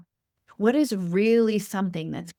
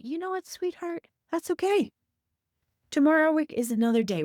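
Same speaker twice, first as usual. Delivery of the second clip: tender self-compassion; 9 semitones higher, faster than usual. Pretend. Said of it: "with beautiful compassion, self-love, ... say".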